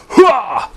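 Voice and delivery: very high, loud soldier's whoop; loud battle cry. Acted. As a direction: let out a gung-ho whoop.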